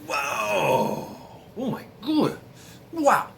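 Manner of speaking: wide-eyed, rising amazement; group of travellers gawking at a castle, awed; awed gasp. Retold or imitated. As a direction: stagger at the size of it. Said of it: "and they're like".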